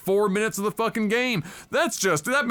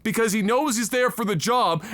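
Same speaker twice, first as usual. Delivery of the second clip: ordinary talk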